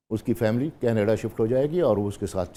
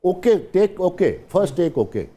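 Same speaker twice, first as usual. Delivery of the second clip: calling take okay; 8 semitones higher, 4 dB louder than usual. Retold or imitated. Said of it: "ٹھک کرتے".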